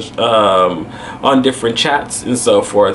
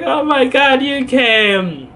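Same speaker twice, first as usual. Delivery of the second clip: high, drawn-out greeting; acted friend's surprised welcome; imitated amazed relief; 10 semitones higher, slower than usual. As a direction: let it slide down from high in amazement. Friend's reaction on arrival, imitated.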